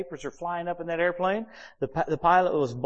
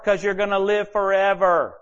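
loud, raised preaching punch; emphatic proclamation; 5 semitones higher, 7 dB louder than usual. Real, not acted. Preacher answering frustrated people, loud proclamation. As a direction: proclaim it big and high.

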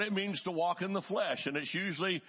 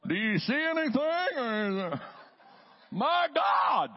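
spy at the binoculars, higher, cutting loose with a hammed-up shout; mock startled spy; 9 semitones higher than usual. Preacher acting out a church spy committee, hammed-up shocked shout. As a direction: play a shocked lookout, half joking. Mock spies checking for circumcision.

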